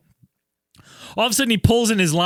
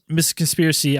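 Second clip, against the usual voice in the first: ordinary talk, nothing marked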